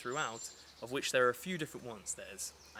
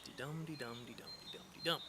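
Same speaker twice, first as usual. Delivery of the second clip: "Dee-dum" like footsteps, "dum" stressed, going quieter into a whisper; walking rhythm; 6 dB quieter than usual. Shown as a demonstration. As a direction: step out "dee-dum", fading. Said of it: "walking pace".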